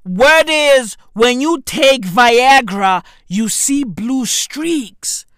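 loud, slow, drawn-out announcement; emphatic proclaiming. Real, not acted. Comic announcing the Viagra rumour, slow loud proclaiming. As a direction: stretch each word out and project it.

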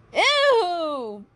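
loud, high, drawn-out ew; exaggerated group groan of disgust. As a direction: wail in mock disgust. Acted disgust at a smell.

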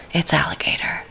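voiceless, hushed reveal; whisper. Shown as a demonstration. Host repeating the dish name, whispering. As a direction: breathe it like a secret. Same line whispered after.

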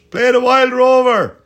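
high, loud, drawn-out crowd yell; heckler shouting. Retold or imitated. Punter in the middle of the festival crowd, shouting out. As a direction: holler a song request over thousands of people. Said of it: "just shouting out".

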